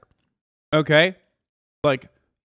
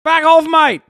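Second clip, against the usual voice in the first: put-on higher, loud Australian accent; playful Australian accent; loud Aussie accent; 9 semitones higher, 9 dB louder than usual. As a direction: bark it like a mock Australian. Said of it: "I have no personality but my accent".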